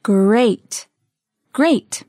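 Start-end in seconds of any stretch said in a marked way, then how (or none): 0.0-0.9 drawn-out single word; slow model. Shown as a demonstration. Teacher modelling a word for learners, drawn out. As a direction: stretch the word out clearly.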